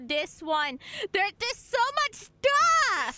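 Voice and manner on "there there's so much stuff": high, drawn-out exclamation; excited amazement. Real, not acted. Player sees so much stuff on the map, excited amazement. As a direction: let it climb high and stretch the last word.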